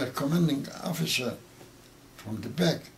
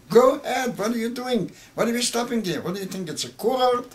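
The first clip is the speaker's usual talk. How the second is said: much higher, louder barked orders, relived; retold officer yelling; 9 semitones higher, 6 dB louder than usual. Survivor recalls the commander screaming at them to keep driving, loud and high. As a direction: shout him back into motion like the officer did. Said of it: "he kept screaming".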